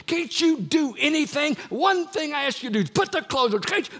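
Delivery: high, loud exasperated hollering at her son; shrill-free but furious mock mother's holler. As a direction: yell it like a mom who's had it. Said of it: "she just started hollering".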